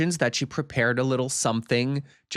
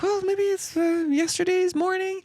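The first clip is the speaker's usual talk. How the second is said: much higher, strained, flustered voice; panicked squeak. Retold or imitated. Cornered hostess inventing an excuse, much higher and flustered. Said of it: "Angela's like".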